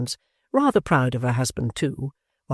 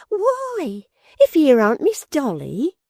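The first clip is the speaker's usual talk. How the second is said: squealing maid; 13 semitones higher, 6 dB louder, slower than usual. A narrator's reading. Maid Miggs exclaiming.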